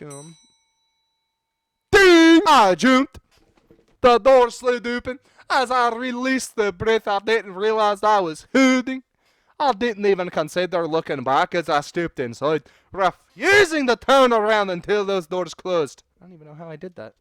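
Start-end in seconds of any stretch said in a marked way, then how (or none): 1.9-2.4 very loud, high ding; imitated elevator ding. 2.5-16.0 loud, hammed-up Scottish storyteller; Scottish accent. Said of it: "how to do the Scottish accent".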